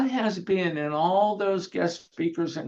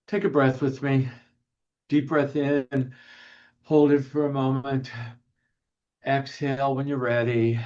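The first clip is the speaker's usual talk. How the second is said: calm guiding voice, a touch lower; guided breathing; 4 semitones lower than usual. Acted. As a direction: lead them gently through one breath.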